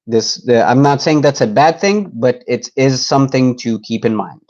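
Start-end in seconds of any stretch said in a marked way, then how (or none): none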